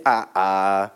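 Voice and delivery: "Ah, ah." said low, each "ah" doubled for emphasis in Pidgin; Pidgin emphatic "ah, ah". Shown as a demonstration. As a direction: drop low and repeat "Ah, ah." as a Pidgin exclamation. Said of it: "So we say".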